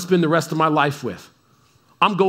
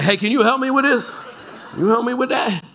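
laughing plea; 5 semitones higher, faster than usual. Retold or imitated. Joking about sweaty car seat.

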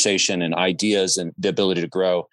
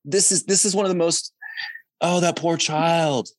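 high-pitched, pitying reaction; pitying dismay; 7 semitones higher than usual. Retold or imitated. Onlooker feeling for a scolded toddler, pitying dismay. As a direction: lift the voice high, drawing out the pity.

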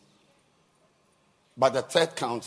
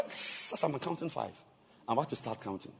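usual speaking voice

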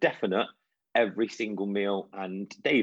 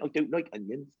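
lightly done West Country whine, rising at the end; a hint of West Country, like a fussy little boy; West Country accent; 4 semitones higher than usual. Brother imitated refusing onions in a mild West Country accent. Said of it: "in his West Country accent".

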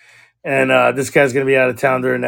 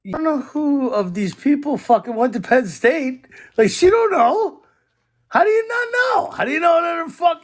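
much higher, disbelieving cry; can't believe what she's saying; incredulous shout; 12 semitones higher than usual. Retold or imitated. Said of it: "So I'm like".

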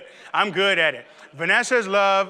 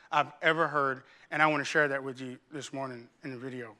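normal talking voice

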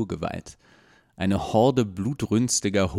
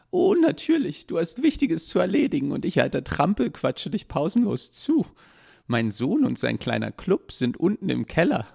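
high, gushing busybody voice; chatty old woman; 10 semitones higher than usual. Acted. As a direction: prattle on like a nosy old lady. Landlady speaks again.

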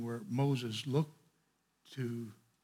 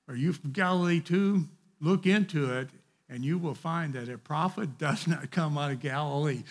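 scoffing; 4 semitones higher, 8 dB louder than usual. Acted.

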